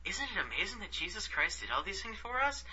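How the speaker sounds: a hint of a glassy-eyed true believer; mocking the sister missionary's wide-eyed wonder; starry-eyed missionary. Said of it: "glassy eyes and be like".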